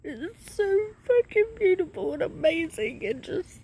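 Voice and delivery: high, tearful voice breaking with joy; crying through the words, overwhelmed with relief; happy crying. Real, not acted. To her viewers, sobbing with happy tears. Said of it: "I'm crying because I'm happy".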